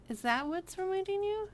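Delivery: pitched well up, curious; surprised question. Real, not acted. Streamer reads a chat guess, asking back surprised. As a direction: ask it with sudden surprise.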